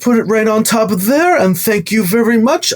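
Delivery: high-pitched, polite shopkeeper patter; chipper shop man. Acted. Gift shop man to a customer packing up, high chipper voice. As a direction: play a busy shop man in a high put-on voice.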